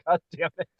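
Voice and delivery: usual speaking voice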